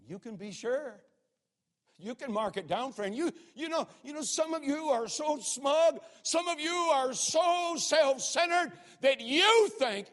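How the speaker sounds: high, loud, building sermon cry; preaching at full force. Acted. Preacher rebuking smug, self-centered listeners, rising loud. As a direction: climb and hammer at the smug.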